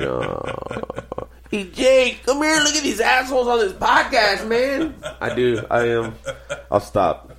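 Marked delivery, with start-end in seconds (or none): 1.5-5.0 put-on high-pitched Spanish-accented goofball; mock Spanish accent. Calling Jay over to gawk at podcasters, mock Spanish accent. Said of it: "you make him sound Spanish".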